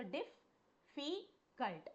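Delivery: "difficult" slowly split into its parts, the double F held; syllable by syllable. Shown as a demonstration. Teacher shows students the syllables of "difficult", slowly. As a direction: spell out "difficult" by sound, slowly. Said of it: "You are having two F".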